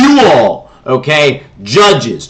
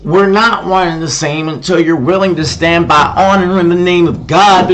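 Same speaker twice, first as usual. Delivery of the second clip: ordinary talk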